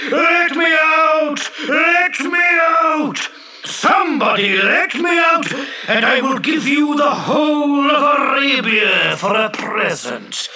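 very high, long-drawn genie shouts; calling out. Acted. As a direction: yell desperately to be released. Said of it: "I called out".

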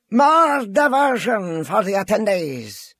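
high, slow, stretched announcement; drawn-out arena announcer; announcer's cry. A narrator's reading. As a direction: call it out to the whole crowd. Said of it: "cried the announcer".

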